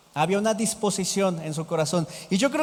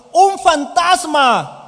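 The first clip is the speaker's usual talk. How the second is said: loud, high, drawn-out yell; full-voiced shout of fright; shouted cry of alarm; 7 semitones higher, 8 dB louder, much slower than usual. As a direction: scream it out like terrified fishermen.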